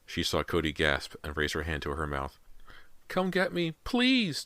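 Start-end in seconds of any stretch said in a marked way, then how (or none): 3.1-4.4 put-on high, pleading little boy; begging child. A narrator's reading. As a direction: beg like a frightened small child. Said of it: "James begged".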